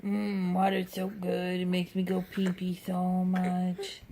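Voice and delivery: dad playfully voicing his son's thoughts; voicing the baby. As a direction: speak for the baby as if he's talking. Dad voices the baby's thoughts.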